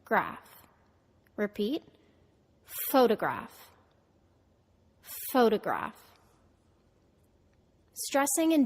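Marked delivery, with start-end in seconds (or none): 0.0-0.7 graph with middling stress, lower in pitch; secondary stress. Shown as a demonstration. Teacher isolating the end of photograph, secondary stress. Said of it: "is a secondary stress". 2.9-6.0 photograph with PHO strong, middle syllable reduced; stress on first syllable. Shown as a demonstration. Teacher models a word for students to repeat, first-syllable stress. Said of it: "The first syllable is stressed".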